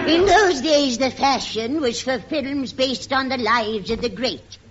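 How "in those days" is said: ordinary talk, nothing marked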